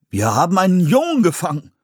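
high, astonished exclamation; surprised. A narrator's reading. Settler man finding a boy in the trap, surprised. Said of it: "stellte ein Mann überrascht fest".

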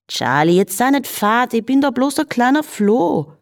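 a little flea sweet-talking in Viennese singsong; cheeky flea. A narrator's reading. Flea character in Viennese dialect.

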